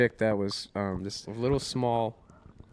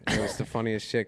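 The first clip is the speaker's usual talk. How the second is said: ordinary talk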